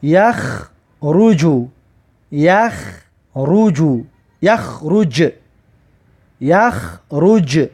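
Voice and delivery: یخ رجو stretched slowly; slow recitation. Shown as a demonstration. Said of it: "در آخر کلمه میارد که".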